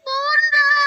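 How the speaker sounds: put-on high, slow small girl's voice; little girl Cici. Child Cici. (suara anak perempuan kecil)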